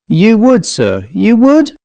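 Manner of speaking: high-pitched servant, rising to a question at the end; astonished, hopeful high note; high gasped disbelief. A narrator's reading. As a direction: gasp it out high, hardly believing it. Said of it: "He gasped it out on a high cracked note".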